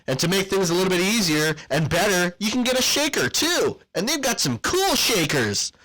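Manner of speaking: ad read. Acted. Hyped sponsor ad read.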